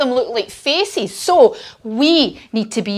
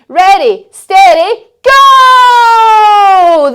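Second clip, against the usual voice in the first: climbing higher and louder to a full shout on go; building ready steady go; 8 semitones higher, 9 dB louder, much slower than usual. Shown as a demonstration. Starting the children's race, building to a big shout. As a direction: build it up and yell go. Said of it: "And we're gonna say".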